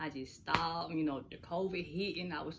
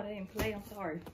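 ordinary delivery

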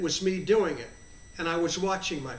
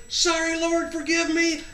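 high, loud called-out apology; yelling back; 10 semitones higher, 6 dB louder than usual. Retold or imitated. Runner apologizes to the Lord behind him, yelling back. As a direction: yell it over your shoulder mid-run. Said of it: "I would yell out behind me".

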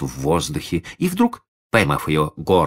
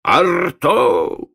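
raised, threatening command; booming circus ringmaster; 12 semitones higher, 4 dB louder, much slower than usual. A narrator's reading. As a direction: boom the dog's name out, stern. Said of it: "грозно возвысил голос Лодыжкин".

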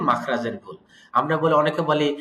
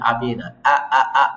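normal talking voice

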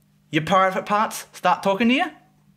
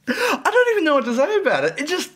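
helpless higher-pitched giggly speech; laughing; 8 semitones higher than usual. Real, not acted. Reviewer losing it over a vulgar fanfic, laughing. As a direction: break up laughing while trying to talk. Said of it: "I don't know why I'm laughing at this".

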